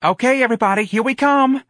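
high, loud showgirl announcement; bright-pitched stage holler; called out. A narrator's reading. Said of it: "Darlene called".

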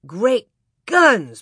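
loud exclamation with a hint of sleepiness; sleepy exclamation. Pa woken by the boom, exclaiming. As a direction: exclaim it, only lightly sleepy. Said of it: "Pa exclaimed sleepily".

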